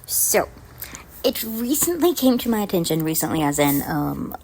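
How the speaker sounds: usual speaking voice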